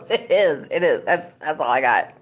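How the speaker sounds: usual speaking voice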